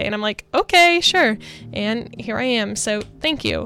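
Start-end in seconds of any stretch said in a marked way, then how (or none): 0.5-1.4 high, loud "okay, sure"; mock overwhelmed agreement. New recruit gives in to the pushy Edward, high-pitched and loud. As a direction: go along with it, voice jumping up high.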